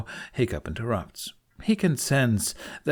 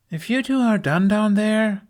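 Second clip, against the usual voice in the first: much higher, louder call; calling out, unamused; 12 semitones higher, 7 dB louder than usual. Acted. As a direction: call out to cut them off. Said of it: "Astrid calls out, unamused".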